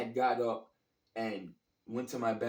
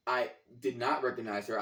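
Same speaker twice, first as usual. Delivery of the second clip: normal talking voice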